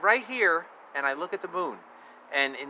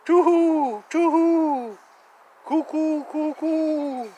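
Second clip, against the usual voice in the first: high hooting bird call; playful high cooing; pigeon coo; 10 semitones higher, 7 dB louder, slower than usual. Pretend. Imitating bird calls in rhyme.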